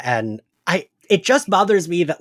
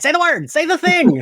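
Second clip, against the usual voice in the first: much higher, louder, laughing urging; laughing mock plea; 12 semitones higher, 3 dB louder than usual. Urging the show to say somnambulist, laughing and pleading. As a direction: beg the show through a laugh. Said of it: "I'm like".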